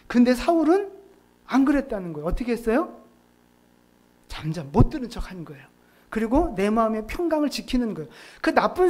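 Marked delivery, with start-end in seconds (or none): none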